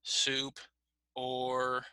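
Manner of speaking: "Soup" and "or" at the same length; two even beats, no linking; said like a robot, word by word; equal robotic timing. Shown as a demonstration. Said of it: "okay, you try it".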